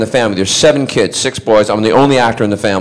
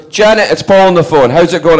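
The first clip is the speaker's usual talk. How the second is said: high, loud imitation of dad calling out; calling to wife; 7 semitones higher, 5 dB louder than usual. Dad telling Janet their son is phoning, excited. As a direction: call the news across to your wife, high.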